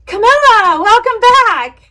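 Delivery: put-on light up greeting the returning kid; excited greeting. Shown as a demonstration. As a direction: gasp then high, loud welcome. Greets returning student warmly.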